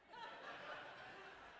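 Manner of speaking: high airy laugh at himself; rueful laugh at a hopeless situation; breathy rueful laugh. Real, not acted. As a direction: laugh it off, half groaning. Laughing at his own misery.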